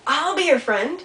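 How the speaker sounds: high, loud offer called across water; calling out. Acted. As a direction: call it out to someone across the pond. Beaver calls offer across pond.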